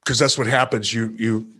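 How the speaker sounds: usual speaking voice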